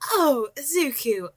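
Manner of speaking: relieved high exclamation; exclaiming. A narrator's reading. Mitsuki relieved to see Izuku, high glad exclamation. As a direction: gush with relief at seeing him. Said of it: "she exclaimed".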